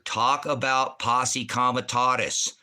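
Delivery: slow, hammered-out words; insistent demand. Real, not acted. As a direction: demand it slowly, word by word. Angry demand to stay on topic.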